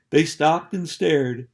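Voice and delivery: ordinary delivery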